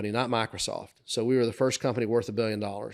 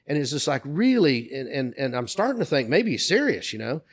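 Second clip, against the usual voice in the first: usual speaking voice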